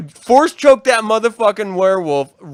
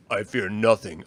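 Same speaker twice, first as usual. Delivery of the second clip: low, quiet, menacing Vader; deep Vader; 12 semitones lower, 7 dB quieter than usual. Pretend. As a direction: lower voice, say it like a Sith Lord. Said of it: "And he's like".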